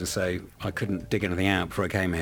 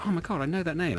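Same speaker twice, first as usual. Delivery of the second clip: high-pitched replay of a surprised thought; mock-startled recognition, high and light; retold sudden realisation; 10 semitones higher than usual. Retelling his sudden recognition.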